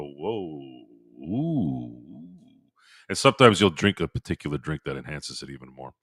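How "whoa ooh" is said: soft, drawn-out dazed "whoa, ooh"; dizzy whoa. Retold or imitated. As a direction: breathe it out like a head rush. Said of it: "your head starts to go".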